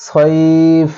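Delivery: ছয়ফ with the ai stretched one alif long; slow stretched ছয়ফ; drawn-out sayf. Shown as a demonstration. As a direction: hold the vowel in ছয়ফ, the final sound still. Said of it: "লাস্টে থামতে হয়".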